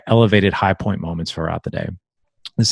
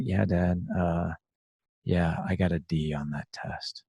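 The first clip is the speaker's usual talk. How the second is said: much softer, hesitant admission of a bad grade; sheepish kid confession; 3 semitones lower, 12 dB quieter, slower than usual. A dad imitating his kid mumbling softly about the D.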